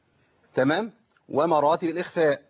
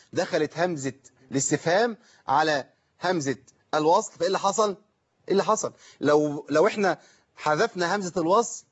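ordinary talk

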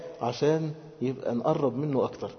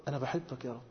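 hushed confession of love; tender devotion; 2 semitones lower, 12 dB quieter than usual. Acted. Worshipper telling the Lord of his love, tenderly.

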